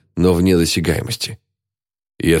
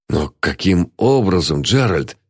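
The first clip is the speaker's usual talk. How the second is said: plain reading voice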